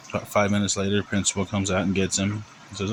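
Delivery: ordinary delivery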